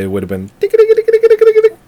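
very high, loud ticking clock noise; ticking sound effect. Shown as a demonstration. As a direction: tick it out like a cartoon clock. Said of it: "any sound effect that went with".

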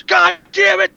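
high, loud exasperated curse; mock outburst. Fed-up viewer at home yelling at the screen, mock outburst. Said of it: "And then the wide mouth goes".

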